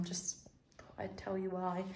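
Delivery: soft whispered aside. Real, not acted. Aside to viewers, unvoiced.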